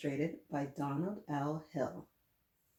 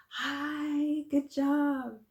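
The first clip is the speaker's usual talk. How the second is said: cheery hi to the class; excited greeting; 9 semitones higher, 5 dB louder, much slower than usual. Real, not acted. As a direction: greet them with big enthusiasm. Teacher greets kids on camera.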